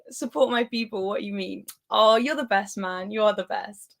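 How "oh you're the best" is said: ordinary talk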